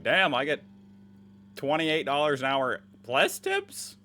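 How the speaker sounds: loud, high, drawn-out excitement; mock amazement. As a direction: crow in exaggerated amazement at your luck. Acts out amazed worker at high pay.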